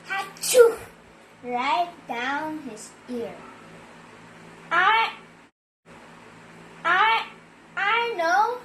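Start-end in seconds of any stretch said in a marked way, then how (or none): 0.1-0.8 big loud fake sneeze. Cow sneezing into sleeping dog's ear, loud. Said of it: "cow went up to dog and went". 4.7-8.6 sheep's voice. Acted. Sheep stammering an idea to the animals, higher voice. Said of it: "said sheep".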